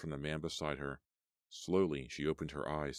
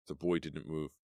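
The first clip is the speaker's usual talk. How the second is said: usual speaking voice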